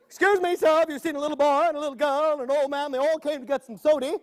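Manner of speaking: grandma. Acted. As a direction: ask it like a hurried grandma.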